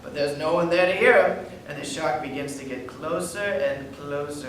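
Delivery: ordinary talk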